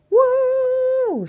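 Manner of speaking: high, loud woo in midair; joyful yell. Acted. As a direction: yell with the thrill of flying. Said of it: "she yelled".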